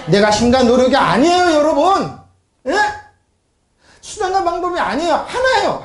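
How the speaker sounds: high, insistent pulpit voice; insist, pitch climbing high; emphatic preaching. Real, not acted. Preacher tells congregation human effort fails, emphatic and high.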